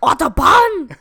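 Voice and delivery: full-volume mock-German bellow; aggressive German accent. Naming German words for laughs, bellowed German accent. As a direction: blast it out in caricature German. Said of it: "Let's just name all the German words".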